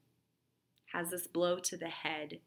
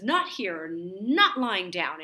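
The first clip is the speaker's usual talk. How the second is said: louder, slow, drawn-out defiance; defiant refusal; 8 dB louder, slower than usual. Acted. Dying Cyrano refusing to die lying down, loud slow defiance. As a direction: refuse death with force, slowly.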